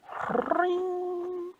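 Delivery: very high sustained ringing zap; high magical "pring"; magic spell zing. Acted. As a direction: trill a long, piercing spell noise. Magic spell sound imitated.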